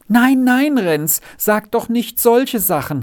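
horrified cry. A narrator's reading. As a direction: beg in great fright, pitch leaping up. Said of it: "rief das Margretli in großem Schrecken aus".

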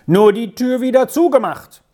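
calling out. A narrator's reading. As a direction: tell the stranger off through the door. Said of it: "rief Vinzenz hinaus".

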